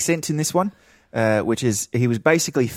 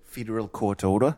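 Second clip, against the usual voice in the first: Kiwi accent with dropped r's; New Zealand accent; 4 dB quieter than usual. Shown as a demonstration. Friend tests the trick phrase, New Zealand accent. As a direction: try it in a Kiwi accent, rising to a question. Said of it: "can't say federal court order in an American accent".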